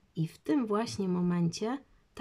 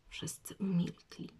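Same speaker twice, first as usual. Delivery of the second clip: ordinary delivery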